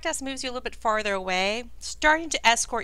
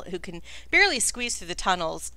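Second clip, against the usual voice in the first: ordinary talk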